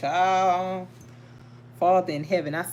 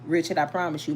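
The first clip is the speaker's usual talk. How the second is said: usual speaking voice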